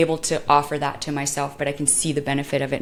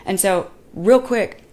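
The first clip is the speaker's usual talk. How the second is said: ordinary talk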